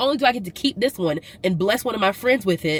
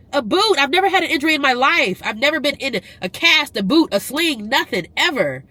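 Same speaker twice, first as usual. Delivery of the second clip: higher and louder, fast disbelief; indignant; 7 semitones higher, 4 dB louder than usual. Real, not acted. Vlogger ranting to viewers about her first injury, indignant. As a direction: rant in disbelief.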